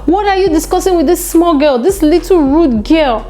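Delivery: mock-scold like an outraged aunty; scolding nanny.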